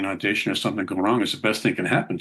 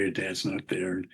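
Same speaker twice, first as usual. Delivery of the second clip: softer and slower, trailing off; quiet sad echo; 7 dB quieter, slower than usual. Real, not acted. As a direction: let the words sink, quietly. Echoing line, quiet and slow.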